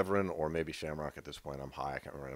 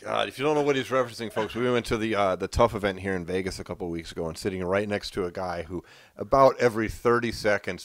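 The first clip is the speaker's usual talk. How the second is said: usual speaking voice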